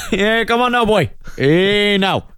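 high mock Welsh coaxing; lightly hammed Welsh accent; Welsh Bard. Bard goading the dwarves, mock Welsh.

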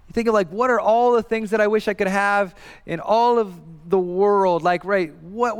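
usual speaking voice

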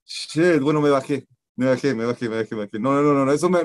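normal talking voice

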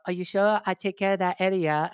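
mock Chinese accent, dropped r's; imitating Chinese-accented old lady. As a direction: press the offer in a put-on Chinese accent. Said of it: "she's like".

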